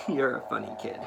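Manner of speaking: ordinary delivery, nothing marked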